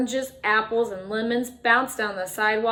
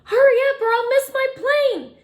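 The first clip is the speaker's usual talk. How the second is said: hurried passenger; 14 semitones higher, 7 dB louder than usual. A narrator's reading. Woman urging taxi driver.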